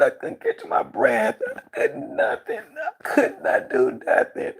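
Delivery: slow, high and breaking, half laugh half cry; tearful. Real, not acted. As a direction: push the words out through tears. Reliving seizure, crying.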